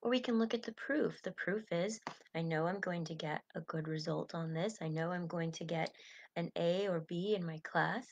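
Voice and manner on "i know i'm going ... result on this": normal talking voice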